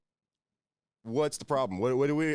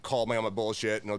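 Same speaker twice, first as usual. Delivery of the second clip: ordinary talk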